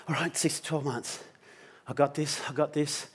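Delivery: soft, subdued resolve; steady yourself under your breath; quiet self-reassurance. Retold or imitated. Friend absorbing a six-to-twelve month prognosis, quietly steadying himself.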